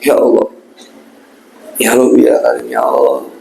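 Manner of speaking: demonstrating a sincere plea of repentance; remorseful prayer. Shown as a demonstration. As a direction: pray as if truly sorry, voice sinking at the end. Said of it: "Yang betul-betul penyesalan".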